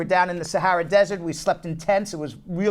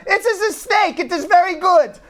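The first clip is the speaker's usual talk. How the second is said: high-pitched foreign waiter accent, played for laughs; broken English; 11 semitones higher, 5 dB louder than usual. Waiter assuring diners the snake is good, in broken English. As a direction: imitate the waiter's broken English brightly insisting. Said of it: "in his broken English".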